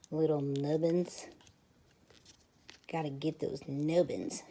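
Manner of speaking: a hint of a silly cartoon voice; silly nubbins voice. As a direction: play with the word nubbins in a mock-funny voice. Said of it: "I don't know why I have to say it like that".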